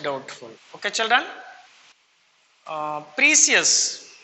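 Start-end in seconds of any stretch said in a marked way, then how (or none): 3.2-4.1 "precious" as PREE-see-us; mispronounced precious. Shown as a demonstration. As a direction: stretch "precious" slowly into three syllables, PREE-see-us. Teacher first mispronounces, then corrects.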